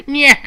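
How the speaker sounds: very high, loud short jeer; a quick cartoon nyah; taunting nyah. Villain taunts viewers again, short high nyah.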